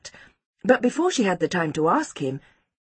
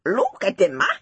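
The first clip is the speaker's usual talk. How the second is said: high-pitched insistent accusation; eager tattling to teacher; blurted out; 11 semitones higher than usual. A narrator's reading. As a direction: blurt out evidence in a high child's voice. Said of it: "Seppo blurted out".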